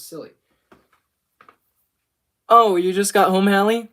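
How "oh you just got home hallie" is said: loud, raised greeting across the house; real off-the-cuff shouted question; calling out. Real, not acted. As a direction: call over to someone just walking in. Calls out to someone arriving home.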